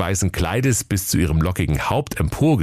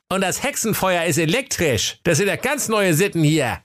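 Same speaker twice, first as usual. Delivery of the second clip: high, pitched-up grumbling scold; scolding Wilhelm; 12 semitones higher than usual. Acted. Wilhelm scolds his brother about the fire, high mock grumble. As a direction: huff about the electric fire. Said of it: "Der Wilhelm schimpfte".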